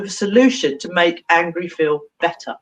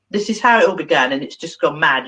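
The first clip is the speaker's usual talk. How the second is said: usual speaking voice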